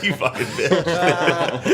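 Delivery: slow, laughing "you fucking bitch"; laughing mock insult. Laughing back at a friend's jab, slow and amused. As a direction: drag it out through laughter. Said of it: "I was like".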